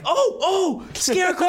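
very high, laughing squeal; excited recognition. Retold or imitated. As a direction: squeal the name with delight. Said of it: "I'm like".

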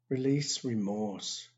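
normal talking voice

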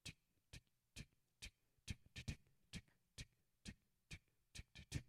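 soft unvoiced tongue-click ticks in rhythm; whispered tick tick of water dripping; imitated dripping tick. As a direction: click out the drip like a clock. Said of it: "and I heard tick".

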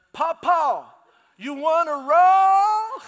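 loud, high, drawn-out yell at a deaf grandpa; hammed-up slow shout to a hard-of-hearing father; dramatic shout. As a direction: bellow it slowly across the table. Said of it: "A little dramatic".

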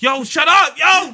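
high, loud shout out the window; mock angry shout. Yelling from the window at the noisy neighbors, a high mock shout. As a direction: yell at the neighbors to shut it off.

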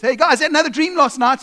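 high and loud, bursting to tell; eager kid. Retold or imitated. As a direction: announce the dream excitedly. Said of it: "The next day he goes".

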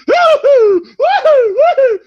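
high shrieking laugh-cry; high loud laughing cry. Acted. Mock panic outburst.

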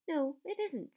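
plain reading voice

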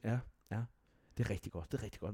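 soft, muted praise; quietly humoring a failed student; the school head quietly humoring the nervous young applicant; a touch of a hushed teacher. Retold or imitated. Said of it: "så siger hun".

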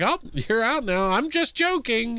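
high, sugary grandma voice; sweet old lady voice. Retold or imitated. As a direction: coax sweetly as a creepy grandma. Quoting the grandmother.

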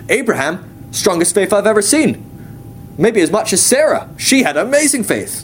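high, enthusiastic rave; God, gushing praise. Retold or imitated. God recalling Abraham and Sarah's faith, gushing. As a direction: imitate someone raving in admiration.

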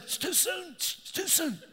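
high, squeaky choked-up voice; hammed-up crying, barely getting it out; mock tearful. Husband to wife after a sad movie, mock tearful.

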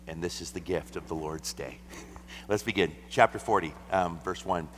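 normal talking voice

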